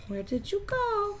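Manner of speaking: high, slow, soft call; calling lost pet. Pretend. Player searching for the lost kitty cat, high drawn-out call. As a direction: draw it out high and slow, softly.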